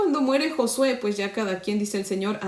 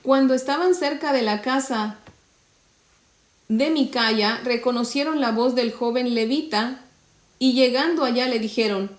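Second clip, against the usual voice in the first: raised, measured Bible reading voice; scripture reading; 3 semitones higher than usual. Acted. Preacher reading the verse to the women, raised reading voice. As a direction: read the verse out to the congregation. Reads the Bible passage aloud.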